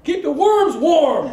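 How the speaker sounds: loud slowed-down punchline. Acted. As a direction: drag each word out through a laugh.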